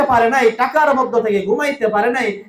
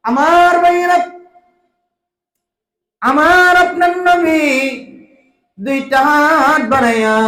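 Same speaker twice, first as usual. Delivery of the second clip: melodic sermon call; 3 dB louder than usual. Real, not acted. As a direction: call the crowd in sung preaching. (উঁচু সুরে টেনে ওয়াজ)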